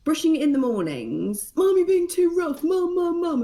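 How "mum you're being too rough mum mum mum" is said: put-on high, loud child's complaint; imitating a daughter protesting; whiny child. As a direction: whine like a kid whose hair is pulled. Child whining at rough brushing.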